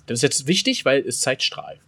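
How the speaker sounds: usual speaking voice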